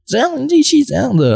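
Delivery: put-on squeaky high child voice whining; mocking a child. Coach mimics the kid's high whine. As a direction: whine it like a cheeky little kid. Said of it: "他说".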